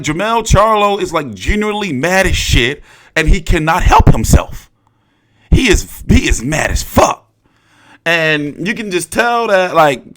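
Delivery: ordinary talk, nothing marked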